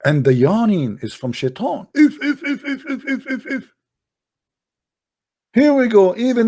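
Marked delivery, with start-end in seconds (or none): 1.9-3.7 high, repeated squeaky little snicker; rapid-fire squeaks in a high voice; mock devilish snickering. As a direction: make short, high pulsing noises. Imitated noise after naming Shaitan.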